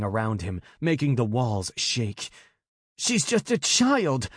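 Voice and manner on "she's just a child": high, slow anguished cry; anguished anger. A narrator's reading. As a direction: plead in raw fury. Enraged brother.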